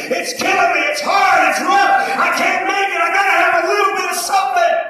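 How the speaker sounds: high, loud, frantic begging; desperate pleading. Retold or imitated. Man in withdrawal yelling to his wife, desperate pleading. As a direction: cry out in withdrawal pain.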